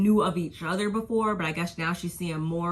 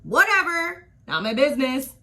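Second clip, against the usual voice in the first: sassy dismissal; 8 semitones higher, 7 dB louder than usual. Real, not acted.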